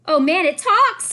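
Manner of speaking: loud, high, slow mocking scream; bully screaming in mock shock; screamed taunt. A narrator's reading. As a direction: shriek it like a bully jeering. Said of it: "screamed Eddie".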